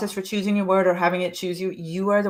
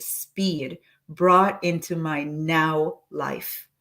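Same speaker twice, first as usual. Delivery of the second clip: very slow affirmation; slow dictation; much slower than usual. Shown as a demonstration. Coach reading the statement slowly so listeners fill blanks. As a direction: stretch the final words out. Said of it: "read the full statement again".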